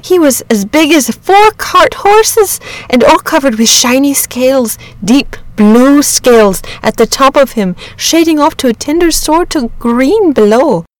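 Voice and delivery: high, animated rustic storyteller; worked-up countryman describing a wonder; excited shepherd. A narrator's reading. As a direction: marvel at the beast in a shepherd's voice.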